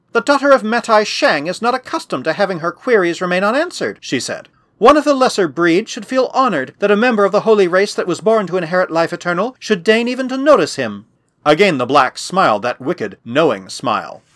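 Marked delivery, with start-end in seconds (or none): 0.1-4.0 put-on imperious girl in a higher pitch; peremptory. A narrator's reading. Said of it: "in a peremptory manner". 4.8-11.1 put-on higher, imperious girl's voice; haughty holy girl. Girl to pirate of the lesser breed, haughty.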